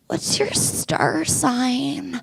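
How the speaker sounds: soft, very slow dreamy barista; spacey barista. Retold or imitated. LA barista asking her star sign, soft and dreamy. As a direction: float the question out, far away. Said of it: "they're like".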